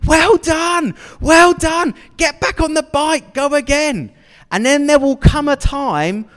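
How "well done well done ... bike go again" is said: high, louder, cheering encouragement; encouraging Jesus. Pretend. Jesus encouraging a believer who failed, cheering. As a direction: urge them gently back on the bike. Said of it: "He's saying".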